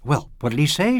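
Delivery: a playful sulk pushed up high and loud; half-playful petulance. Acted. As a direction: pout it out, half in play. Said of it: "she asked with half-playful petulance".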